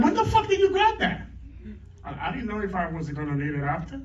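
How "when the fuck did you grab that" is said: high incredulous squeal with a laugh; hammed-up high shriek of disbelief; mock shocked wife. Wife reacts to hidden cigarettes.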